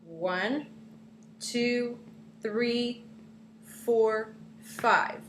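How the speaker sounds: teacher counting out five iambic feet, slowly. Shown as a demonstration. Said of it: "five iambic feet".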